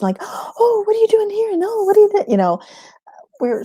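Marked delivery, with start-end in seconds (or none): 0.2-2.3 much higher, playful toddler voice imitating small kids; little kids' voice. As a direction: squeak it out like the little ones tumbling after him. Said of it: "like puppy dogs".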